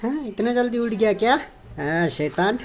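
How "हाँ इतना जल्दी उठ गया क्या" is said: high, sing-song voice for a pet; much higher voice, teasing the pet sweetly; cooing pet talk. Real, not acted. Cooing to pet just woken.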